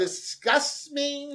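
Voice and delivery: high, slow, grossed-out voice; disgusted by taste. Real, not acted. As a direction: drag it out in disgust. Disgust at lukewarm milk.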